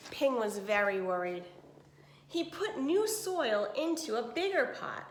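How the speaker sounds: clear, even storybook reading; reading to children. Acted. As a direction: read it to a class of kids. Reads picture book to class.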